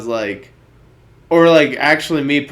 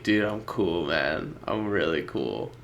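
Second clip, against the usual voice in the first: soft, lower, brushing him off; low refusal; 7 semitones lower, 9 dB quieter than usual. Retold or imitated. Speaker quietly turning down the guy's fake cigarette. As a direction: mumble it down, declining.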